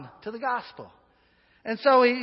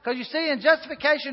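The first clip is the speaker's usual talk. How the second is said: ordinary delivery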